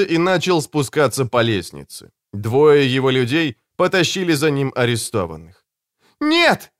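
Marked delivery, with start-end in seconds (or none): 6.2-6.7 high-pitched cry of protest; helpless shriek, like a child. A narrator's reading. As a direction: cry out in high, desperate refusal. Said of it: "закричала Кэтрин".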